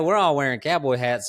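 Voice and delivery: normal talking voice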